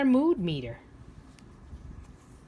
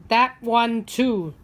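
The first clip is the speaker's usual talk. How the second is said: put-on grumpy old man; 3 semitones higher, 7 dB louder, slower than usual. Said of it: "says the grumpy old man".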